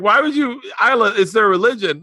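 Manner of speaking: high, loud exasperated dad voice; hammed-up scolding of his daughter; mock scolding dad. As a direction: play yourself squeaky and scandalized.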